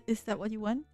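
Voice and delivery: usual speaking voice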